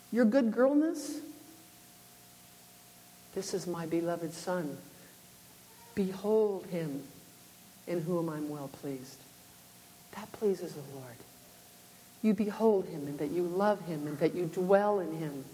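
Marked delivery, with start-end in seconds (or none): 3.3-9.2 soft, low and slow, almost hushed; reverent scripture. Acted. Preacher reciting scripture to the congregation, hushed and slow. 10.1-11.2 soft and slow; hushed reverence. Acted. Preacher telling listeners what pleases God, softly.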